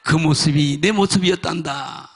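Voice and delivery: low, solemn quoted divine voice; voice from heaven. Retold or imitated. The Lord answers the wronged man, solemn low voice. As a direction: drop low and even as the Lord. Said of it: "억울해하고 있으니까".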